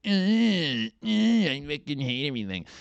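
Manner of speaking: put-on high, groaning teen whine; mock a sulky teenager fed up with everything; hammed-up teenage angst; whiny teenager.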